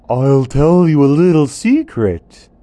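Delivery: put-on a knowing old bird sharing gossip; creepy vulture croak. Vulture confides secret.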